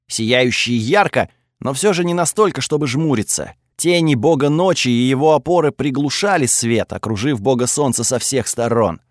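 ordinary delivery

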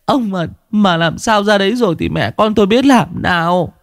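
a wife begging on her knees, sobbing; high, tearful wail of a terrified wife; tearful pleading. Acted. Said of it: "quỳ sụp xuống mếu máo".

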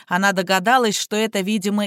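ordinary delivery

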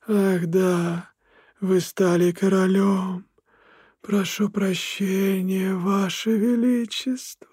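slow, high, sneering spirit; mocking otherworldly voice. A narrator's reading. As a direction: smirk through a cold bow. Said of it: "усмехнулся Смергл". (насмешливый потусторонний голос)

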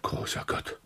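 breathless whisper of horror; stunned whispered oath; shocked whisper. Acted. Inspector finds the girl.

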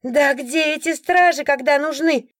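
high, drawn-out complaint; indignant woman. A narrator's reading. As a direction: demand it in outraged exasperation. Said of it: "возмущённо вопрошала какая-то женщина".

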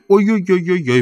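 high, repeated moans of pain; drawn-out groaning. A narrator's reading. Marvel groans again, mock toothache pain. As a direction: let the ouches pile up, hammed-up pain. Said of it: "простонал он опять".

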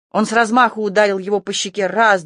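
usual speaking voice

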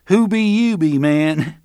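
high, loud jeer with a grin; grinning mockery. Barry still grinning at Roland, high mocking jeer. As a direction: taunt him with a mock accent. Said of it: "Barry was still grinning".

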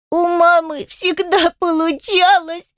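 high, drawn-out tearful wail; high childish bawling; sobbing child. A narrator's reading. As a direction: wail slowly like a little girl in tears. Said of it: "зарыдала Адель".

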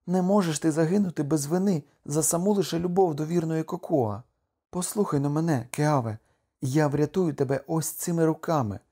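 high-pitched, impassioned pleading; devoted wife. Acted. Voices Kokua's vow.

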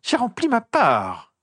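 high, pinched, venomous whisper-hiss; hissing journalist. A narrator's reading. Rita Skeeter hisses back at Harry about their deal. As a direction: spit the words out like a cornered snake.

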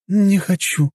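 high-pitched female character voice; refusing woman. A narrator's reading. Woman turning down the service list, high-voiced refusal. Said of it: "помотала головой женщина".

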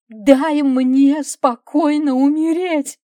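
very loud, high, stretched-out wail; desperate scream. A narrator's reading. Wife begs husband to let her die, desperate scream. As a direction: scream it slowly in despair.

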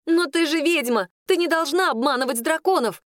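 high, indignant scolding; upset accusing girl. Acted. Claudine says witches mustn't deceive dragons, high and indignant. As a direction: scold her, pitched well up. Клодина упрекает ведьму.

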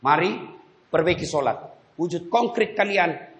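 normal talking voice

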